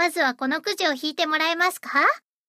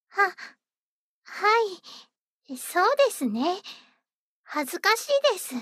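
ordinary delivery

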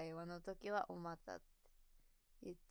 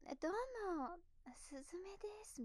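high-pitched, put-on cute self-introduction; cutesy introduction voice; 11 semitones higher than usual. Shown as a demonstration. Streamer showing viewers her usual introduction, cutesy put-on voice. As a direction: introduce yourself in a cutesy, polished voice. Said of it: "可愛こぶってるかもしれない".

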